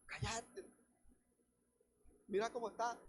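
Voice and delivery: a hint of bystanders shushing the beggar; scolding crowd. Preacher voices the crowd telling Bartimeo to hush, softly.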